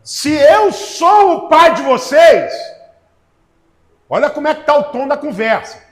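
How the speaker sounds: voz de Deus repreendendo, alta e lenta nas perguntas; pregação inflamada. Acted. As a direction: pregue como Deus cobrando o povo. Preacher voicing God's reproach. (pregador dando voz a Deus, em tom forte de repreensão)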